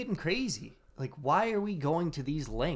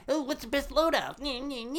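mocking gamer voice; 11 semitones higher than usual.

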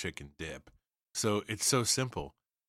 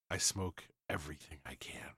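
whispered; 4 semitones lower, 8 dB quieter than usual. Real, not acted. Confessing love of smoking food.

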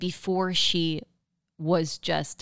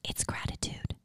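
breathy whispered reveal, much softer; 11 dB quieter than usual. Shown as a demonstration. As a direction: whisper the answer like a secret. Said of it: "reveals the secret".

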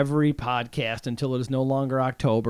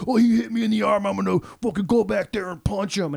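put-on higher, fast bratty kid voice; mocking young kids; 5 semitones higher than usual. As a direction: imitate a whiny seven-year-old threatening a fight. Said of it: "who were like".

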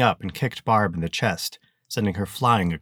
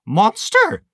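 high, loud scream of alarm; 13 semitones higher, 8 dB louder than usual. Acted. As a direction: scream it as the monster charges. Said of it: "she screamed".

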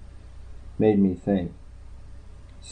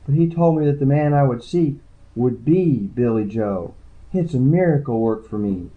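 lightly raised voice for the tired, shy woman; woman's voice; 6 semitones higher, 3 dB louder than usual. Acted. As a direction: read her lines a little higher and wearier. Woman claims miracle.

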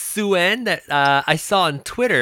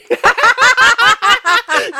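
loud laugh; 8 dB louder than usual. Real, not acted.

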